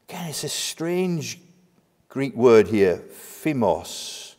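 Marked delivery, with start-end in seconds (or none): none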